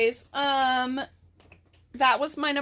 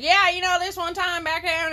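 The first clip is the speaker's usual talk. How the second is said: put-on Southern accent; 4 semitones higher, 4 dB louder than usual. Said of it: "you're suddenly Southern".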